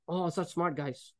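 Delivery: sarcastic. Real, not acted. Mocking the brothers' plan.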